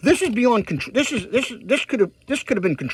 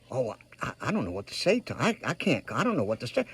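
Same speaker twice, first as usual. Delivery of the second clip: soft, lower, hesitant imitation of a nervous caller; mock timid mumble; 8 semitones lower, 10 dB quieter, faster than usual. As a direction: play the unsure person, barely getting the words out. Mimics someone unsure what to say.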